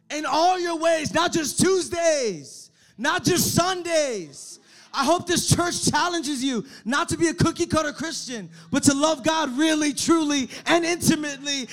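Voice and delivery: loud, fervent preacher's shout; preaching. Acted. Preaching at full force.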